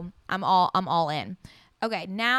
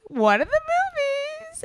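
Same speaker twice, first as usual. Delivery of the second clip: much higher, louder and slow, savoring each word; dramatic high stretch; 6 dB louder, slower than usual. Real, not acted. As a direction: drag it out like a big teaser. Dramatic build-up before the big reveal.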